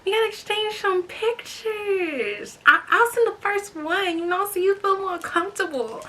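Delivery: high, playful mock of Special K; guy voice imitation. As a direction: act out his smooth talk lightly mocked. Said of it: "Special K's like".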